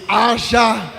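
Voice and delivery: a shouted creation command, half-spoken; the Father shouting. Acted. The Father shouting again into the darkness, a ringing cry. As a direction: call out the single word like a decree. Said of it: "o pai estava gritando outra vez".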